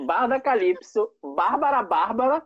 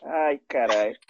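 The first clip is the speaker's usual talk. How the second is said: after laughing hard; 10 semitones lower than usual. Real, not acted. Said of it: "Tô chorando de tanto rir".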